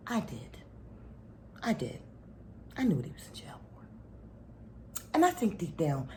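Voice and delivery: soft, fast, near whisper; hushed secret. Real, not acted. Wife revealing the bishop's secret to listeners, hushed. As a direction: confide it like gossip.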